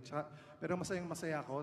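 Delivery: ordinary talk, nothing marked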